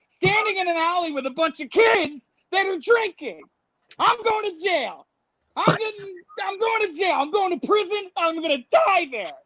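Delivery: high-pitched comic spiral of dread; mock panic. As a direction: wail it like your life is over. Imagined panic at being arrested.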